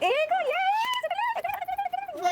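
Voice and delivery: high gleeful chant of सुटली; mother cheering her own win, high and excited. Real, not acted. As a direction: squeal it out, she just won. Winning the game, cheering.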